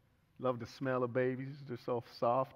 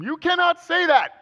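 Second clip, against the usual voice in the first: mock wife's high, loud yell; calling from another room; 17 dB louder than usual. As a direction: holler it high across the house. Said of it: "calls from the other room".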